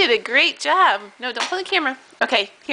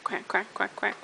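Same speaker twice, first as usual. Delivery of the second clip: duck quacking; 5 semitones lower, 10 dB quieter than usual. Shown as a demonstration. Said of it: "what does the duck say?".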